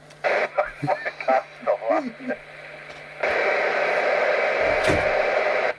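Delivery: long high-pitched cackle of real laughter; big shrieking laugh. Real, not acted. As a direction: break up into a high, helpless laugh. Joking about Bruce crying in bath.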